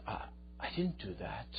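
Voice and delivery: soft, halting acted denial; quiet, stammered protest; hushed denial. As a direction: imitate a taken-aback friend, voice dropped low in volume. Voicing accused friend's denial.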